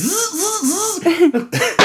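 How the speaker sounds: high drill buzz in repeated bursts; high-pitched mock drill whir; imitated power drill. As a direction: mimic a drill revving in bursts. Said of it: "when she starts screaming some more".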